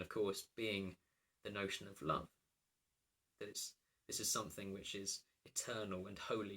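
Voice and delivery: ordinary talk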